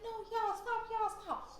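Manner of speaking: high-pitched mock plea to stop fighting; imitated pleading friend. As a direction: imitate a friend begging from the middle of a brawl.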